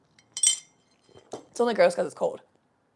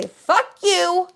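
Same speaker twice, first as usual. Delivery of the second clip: high, loud, slowed-down defiance; shouting down your own fear; defiant shout; 5 semitones higher, 7 dB louder, slower than usual. Pretend. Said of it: "look it in the eyeball and say".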